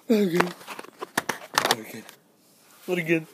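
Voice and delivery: high, rising panicked cries; distressed. Real, not acted.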